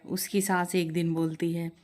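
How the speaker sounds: ordinary delivery, nothing marked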